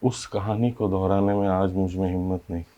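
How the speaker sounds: hushed, heavy-hearted recollection; subdued grief. Acted. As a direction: let the voice sink quietly with sorrow. Telling of the child's death.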